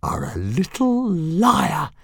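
high, slow menacing hiss; hissing she-dragon. Acted. Fireworm stalking Toothless, slow menacing hiss. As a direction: draw out the threat like a stalking cat. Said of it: "hissed Fireworm".

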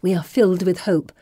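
ordinary delivery, nothing marked

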